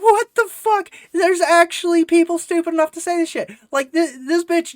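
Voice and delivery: very high, loud incredulous squawk; stunned disbelief. Retold or imitated. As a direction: exclaim it in hammed-up disbelief. Acting out own stunned reaction.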